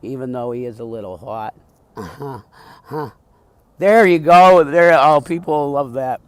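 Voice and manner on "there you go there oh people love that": loud, brassy drag queen voice; sassy drag queen. Acted. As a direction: belt it out like a drag diva. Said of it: "That's my drag character".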